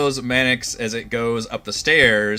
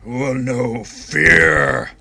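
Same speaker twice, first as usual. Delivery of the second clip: very slow, loud, drawn-out threat; menacing revenant voice; 6 dB louder, much slower than usual. Acted. Revenant threatening Kales, very slow and menacing. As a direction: swell into a long, heavy FEAR. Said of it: "it does not sound like Orvex at all".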